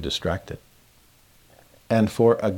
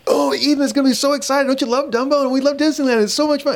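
put-on very high, rapid, gushing parent-to-child voice; over-the-top excited; 3 dB louder, faster than usual. Dad distracting his little daughter at Disneyland, wildly excited. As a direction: overdo the excitement to hold a child's attention. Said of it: "emotive and excited".

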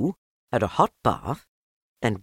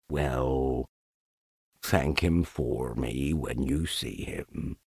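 low, slow, mournful donkey drone; deep, dragging, defeated donkey voice; gloomy Eeyore; 9 semitones lower, 6 dB quieter, slower than usual. Acted. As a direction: sigh each word out like a sad old donkey. Eeyore speaks.

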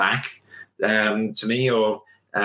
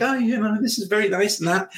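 sheepish hedging; 9 semitones higher than usual. Retold or imitated. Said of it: "sheepishly go around the pond and be like".

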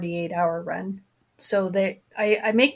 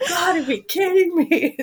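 incredulous exasperation; 9 semitones higher, 4 dB louder than usual. Retold or imitated. Exasperated wife.

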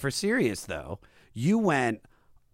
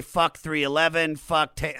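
ordinary talk, nothing marked

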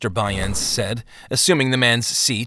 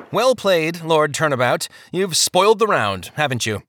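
frosty; 6 semitones higher than usual. A narrator's reading. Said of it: "bit down his ire with a frosty".

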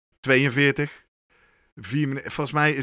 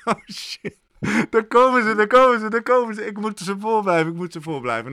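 laughing mock panic; 6 semitones higher than usual. Runner hearing the applause close in, laughing mock panic. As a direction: replay the panic of being caught, hammed up with a laugh. Retold panic as pacers approach.

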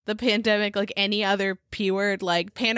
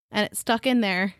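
normal talking voice

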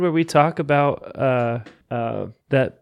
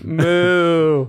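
a long, slightly louder cow moo; 2 semitones higher, 3 dB louder than usual. Acted. Moo back at the donor's name like a cow. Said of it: "shout them out like your cows".

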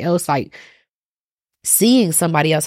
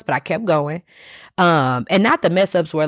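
ordinary delivery

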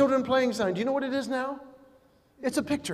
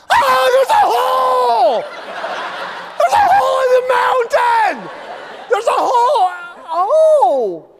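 high, loud, slow panicked shouting at a tunnel; panicked driver; 13 semitones higher, 7 dB louder, slower than usual. Acted. Clueless driver shouting in alarm at the tunnel. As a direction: shriek as if a hole appeared in the mountain. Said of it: "Who is driving going".

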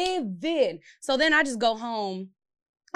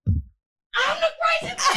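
much higher, louder and slow, shouting the line; outraged shout; 6 dB louder, much slower than usual. Retold or imitated. To her boyfriend after he calls her crazy, an outraged high shout. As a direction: yell it back, stunned and indignant.

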